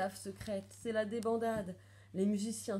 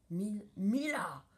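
storming; 2 semitones higher than usual. A narrator's reading. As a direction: thunder at a disgraced daughter. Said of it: "tempête le juge". (tempête de colère indignée)

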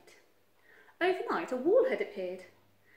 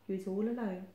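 usual speaking voice

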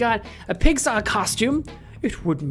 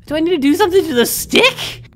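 exasperated question; 10 semitones higher, 7 dB louder than usual. Real, not acted. Frustrated puzzle.

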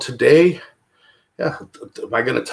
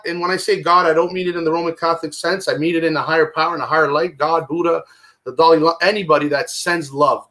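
usual speaking voice

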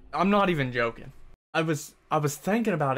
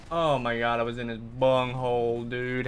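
usual speaking voice

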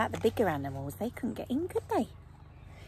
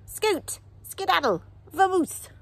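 loud, high, separate commands; shooing away; 12 semitones higher, 7 dB louder than usual. A narrator's reading. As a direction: shoo them off loudly. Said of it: "he shooed them away".

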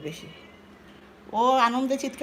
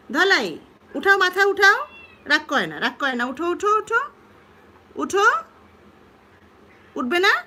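higher, louder calls to get up; loud coaxing; 7 semitones higher, 6 dB louder than usual. Real, not acted. Owner urging her lying-down pet to get up, loud and coaxing. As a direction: rouse the pet with bright urgent calls.

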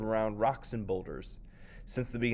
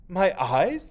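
high, loud horrified gasp; anguished cry; 9 semitones higher, 11 dB louder than usual. Acted. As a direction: cry out in despair. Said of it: "cried out in anguish".

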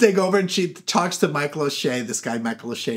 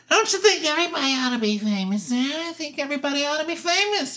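Gladys Glover voice; 10 semitones higher than usual. Retold or imitated. Gladys telling a filmmaker her belief, high mock-naive voice. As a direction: play an eager wannabe, pitched way up. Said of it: "she's really nice, and she says".

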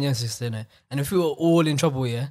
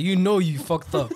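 slow knowing line; slower than usual. Retold or imitated. Slow knowing look, laughing.

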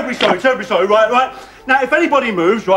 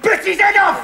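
sudden raised climax to a threat; menacing outburst; 7 semitones higher than usual. Acted. Gunman telling hostages of biting a head off, menacing. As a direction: jump up in pitch on the grisly ending.

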